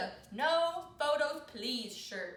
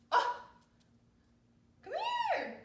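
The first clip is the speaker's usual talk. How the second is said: usual speaking voice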